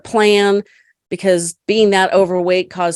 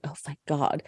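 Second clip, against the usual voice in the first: soft, low whispered relief; relieved sigh; 5 semitones lower, 13 dB quieter than usual. Retold or imitated. Daughter relieved the fight ended, soft low relief. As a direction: breathe it out in relief. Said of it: "I was like".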